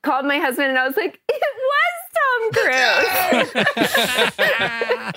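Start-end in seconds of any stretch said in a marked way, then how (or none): 1.3-5.1 very high, drawn-out retold squeal; starstruck squeal. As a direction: stretch it out in a giddy high squeal. Retold call to her husband.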